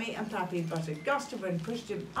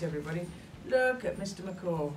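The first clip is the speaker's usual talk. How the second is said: ordinary delivery, nothing marked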